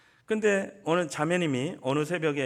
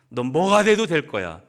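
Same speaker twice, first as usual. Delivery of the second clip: louder, stressing 돼도; emphatic encouragement; 9 dB louder than usual. Shown as a demonstration. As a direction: declare his future boldly. (아들에게 힘주어 외치는 격려)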